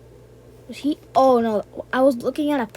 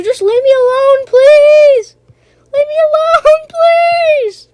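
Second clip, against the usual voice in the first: frantic, much higher and louder begging; panicked pleading; 13 dB louder, slower than usual. Real, not acted. Gamer begs the chasing monster to leave him alone, panicked shrieks. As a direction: shriek it like you're being hunted down.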